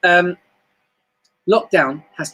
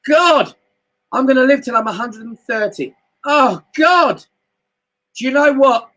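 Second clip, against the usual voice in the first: excited boasting; 8 semitones higher than usual. Retold or imitated. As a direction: shout your good future to the sky. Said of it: "whoever talks like that".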